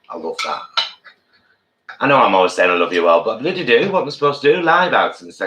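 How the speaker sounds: normal talking voice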